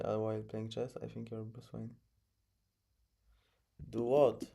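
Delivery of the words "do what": loud, surprised do what; puzzled. Real, not acted. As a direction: snap it back in confusion.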